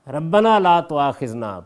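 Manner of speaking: loud, slow Arabic recitation; slow, forceful scripture reading; Quranic recitation. Real, not acted. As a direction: intone the supplication slowly and strongly.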